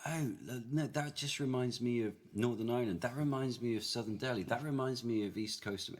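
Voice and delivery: imitated eager recognition, pitched high; excited listeners worldwide. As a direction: pipe up as one listener after another. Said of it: "wherever we are in the world, they go".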